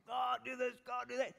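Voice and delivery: high, mock-bossy demands at God; hammed-up nagging at God; mock demanding prayer. Pastor mocking his own demanding prayers, high and bossy. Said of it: "I can do the yelling at".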